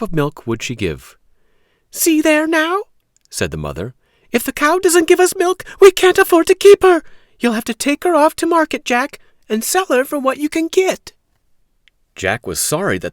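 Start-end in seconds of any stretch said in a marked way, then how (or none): 1.9-2.8 high, loud scolding mother; worried mother. Acted. Mother complaining to Jack about the cow, high and loud. 4.3-11.1 high, loud scolding mother; worried mother. Acted. Mother complaining to Jack about the cow, high and loud.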